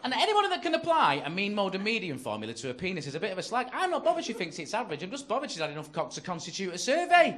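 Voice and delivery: high, loud outraged rant; indignant rant. Acted. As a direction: rant in mock outrage, peaking at the end. Acted indignant rant after the insult.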